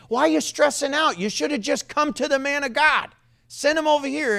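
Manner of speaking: high-pitched incredulous scolding; exasperated "what's the fuss" delivery; chiding prophet. Acted. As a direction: chide a panicking king, pitched well up. Acts out prophet chiding the king.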